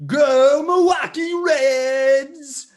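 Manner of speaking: slow, stretched-out cheer; cheering shout. Acted. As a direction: belt it like a fan in the stands. Cheering for his hometown team.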